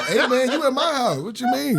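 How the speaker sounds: high, amused complaint; exasperated protest. Retold or imitated. Telling Josh off in his house, exasperated.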